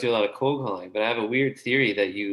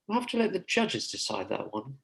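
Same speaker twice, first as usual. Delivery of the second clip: lightly hammed-up formal judge, softer; mock judge voice; 2 semitones higher, 5 dB quieter than usual. Teasing the host by playing the judges, mock formal. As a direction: pronounce the verdict like a stuffy judge.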